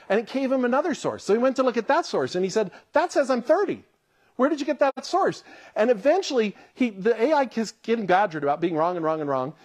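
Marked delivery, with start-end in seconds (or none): 2.9-5.4 exasperated demand. Retold or imitated. Reporter badgering the AI.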